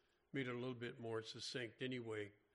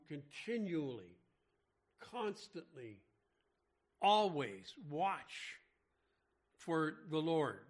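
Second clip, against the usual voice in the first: slow, weighty stress on "continually", "constantly", "always watch"; preacher hammering "always watch" slow and loud; emphatic, drawn out; 6 dB louder, slower than usual. Real, not acted. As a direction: stretch each of "continually, constantly, always watch" slowly, building louder to "for the Lord".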